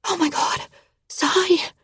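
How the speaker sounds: high breathy panic on Sai; panicked whisper. A narrator's reading. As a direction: gasp it out in fear. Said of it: "I whisper in panic".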